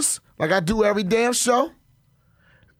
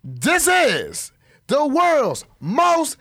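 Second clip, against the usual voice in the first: announcer voice; 4 semitones higher than usual. Acted. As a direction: announce it like a circus ringmaster. Ringmaster podcast intro.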